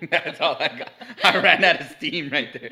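loud, amused admission through laughter; laughing. Real, not acted. Laughs at running out of answers.

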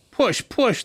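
lightly acted delivery-room coaching; urging. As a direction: urge a mother in labour. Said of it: "the midwife and doctors urge".

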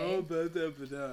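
mocking nonsense babble. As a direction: babble to mock her. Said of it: "Why are you mocking me".

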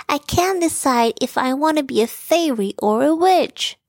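put-on sighing young girl bear. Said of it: "sighed Barry".

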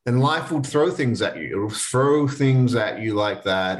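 ordinary delivery

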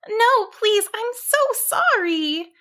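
high, drawn-out squeaky sheep voice; frightened pleading. A narrator's reading. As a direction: plead slowly in a high little voice.

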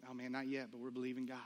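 low, soft, deflated answer; subdued reply. Retold or imitated. Husband answering on a sore subject, low and subdued. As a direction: answer quietly, hope worn thin. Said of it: "starting to be kind of a sore subject".

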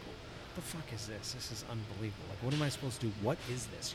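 under your breath, puzzled at the baggie; muttered disbelief. Retold or imitated. Superintendent reliving opening the mystery sample, quiet baffled mutter. Retelling reaction to unlabelled baggie.

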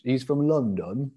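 put-on London accent. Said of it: "someone outside of London doing a London accent".

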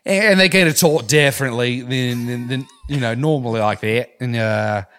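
chatty put-on Australian; Australian accent. Shown as a demonstration. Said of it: "towards Australia".

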